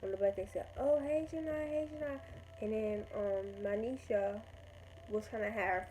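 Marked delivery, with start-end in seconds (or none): 0.8-2.2 high, sing-out hello imitating friends; mock a friend's higher-pitched hailing; calling out greetings. Friends hail her as she boards the bus, calling out.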